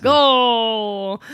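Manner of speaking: loud, long-held announcer cry; mock goal call. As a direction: stretch it out like a soccer commentator. Mock sports commentary, wrong sport.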